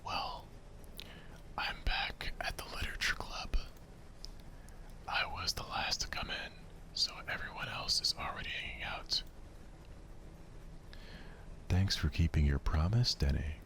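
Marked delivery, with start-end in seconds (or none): 1.6-9.2 soft breathy whisper telling the story; whispered narration. Acted. Narrator setting the club scene, whispered. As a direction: hush it like a secret bedtime story.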